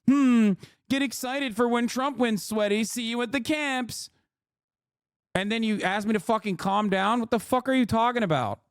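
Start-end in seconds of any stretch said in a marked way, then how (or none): 0.1-4.1 higher, sneering mock of a smug troll. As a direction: drip with fake smugness, imitating the commenter. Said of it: "be like".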